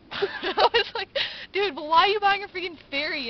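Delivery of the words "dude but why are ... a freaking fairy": higher, loud mock protest; exasperated disbelief. Retelling her challenge to Lucas over the fairy, exasperated.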